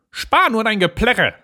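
high-pitched, dismissive witch voice; scolding old witch. Acted. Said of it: "sagte die Alte". (keifende alte Hexe)

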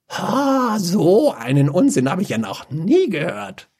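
high, derisive ha then scorn; mocking scoff. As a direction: laugh him off. Mage laughs at nonsense.

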